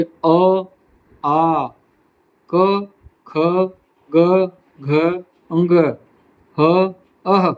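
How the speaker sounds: अ आ क ख ग घ said slowly and clearly from the throat, each sound on its own; teacher sounding out the throat letters अ आ क ख ग घ अंग ह अह one by one; throat sound demo. Shown as a demonstration. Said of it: "कंठ से आप खुद उच्चारण करके देखिए".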